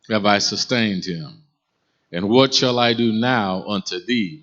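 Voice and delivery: slow, loud, solemn reading; scripture reading. Acted. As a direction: read it out like scripture from the pulpit.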